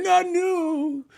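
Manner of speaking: high, slow mock fan voice; squeaky parody of a fan; mocking whiny fan. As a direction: drag it out like a pouting fan. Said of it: "they be like".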